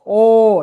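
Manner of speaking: ও held long and loud; long vowel. Shown as a demonstration. Teacher giving an example of a long vowel, drawn-out long vowel. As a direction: draw out a strong ও. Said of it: "এটা long ভাওয়াল".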